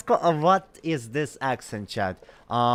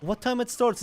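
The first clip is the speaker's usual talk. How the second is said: surprised question; 11 semitones higher than usual. Real, not acted. Puzzled at game time.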